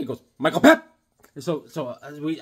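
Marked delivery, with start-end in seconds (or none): 0.4-0.9 loud name announcement lifting at the end; mimicked voicemail greeting. Coworker's voicemail answering a caller, loud mimicked greeting. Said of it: "he has a voicemail".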